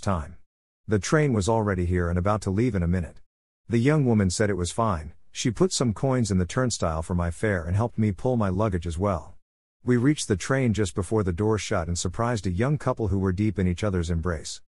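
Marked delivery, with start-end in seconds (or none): none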